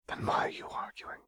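low whisper. Acted. Renata whispers the question to Seraphine. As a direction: breathe the question.

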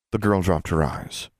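plain reading voice

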